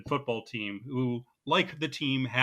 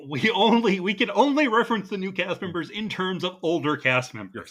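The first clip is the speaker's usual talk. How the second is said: raised, louder mock insistence; mock-earnest; 5 semitones higher, 5 dB louder than usual. Co-host joking about pigeonholing new cast, mock-earnest. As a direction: overdo it, high and emphatic.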